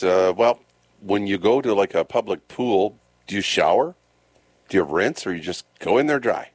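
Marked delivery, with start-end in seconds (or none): none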